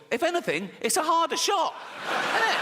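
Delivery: high, squeaky mock outrage; comic high-pitched disbelief; indignant protest. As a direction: argue it upward into an incredulous squeal. Mock protest at the judge's sentence.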